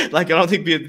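high, loud laugh running into the words. Real, not acted. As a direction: talk through a burst of laughter. Laughs at his own remark.

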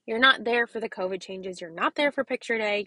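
ordinary talk

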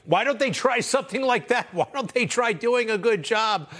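high mock-incredulous questions; sarcastic. Real, not acted. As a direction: needle them with fake wonder. Host mocks opponents after guest's point.

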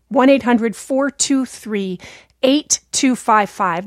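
slow dictation. Real, not acted. Reading out call-in number.

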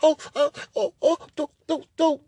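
high, soft whimpering don't break it; whimpering plea. Retold or imitated. The woman pleading with him, high whimpering fear. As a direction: beg fearfully, almost crying.

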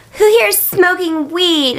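high, slow stage call to a crowd; cute pop-star impression. Imitating the pop singer asking her crowd, high drawn-out voice. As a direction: stretch it out in a cutesy high voice. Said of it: "She was like".

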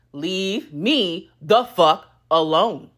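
every word spaced out and slow; slow emphatic. Real, not acted. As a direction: drag it out, one word at a time.

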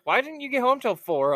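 imitating Kara. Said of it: "Kara's like".

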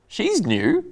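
high-pitched pointed announcement; pointed remark. Real, not acted. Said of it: "don't say it like that".

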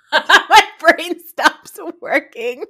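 slow, loud, laughing confession; owning up through giggles. Real, not acted. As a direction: laugh the words out slowly. Laughing at herself.

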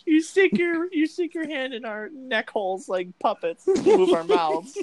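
laughing through it. Real, not acted. Giggling gross-out joke.

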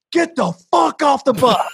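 high, slow mock shout of the bandmate; mock order. Retelling Cody ordering the fan off, high hammed-up yell. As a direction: ham up the bandmate throwing her off. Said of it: "Cody immediately goes".

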